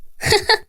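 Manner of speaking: short, high-pitched laugh; mocking laugh. A narrator's reading. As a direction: laugh gleefully at your rival. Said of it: "وقفت وقالت بضحك".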